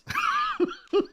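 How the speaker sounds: high-pitched helpless laughing fit; laughter. Real, not acted. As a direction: crack up at your own crude joke. Cracks up at coffee name.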